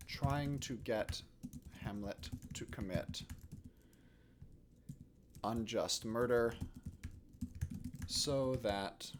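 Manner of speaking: slow, halting dictation with long pauses; composing aloud. Real, not acted. Teacher drafting a play summary for students, slow dictation. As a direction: dictate softly to yourself, phrase by phrase.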